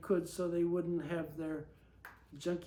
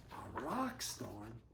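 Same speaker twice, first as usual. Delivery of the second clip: plain reading voice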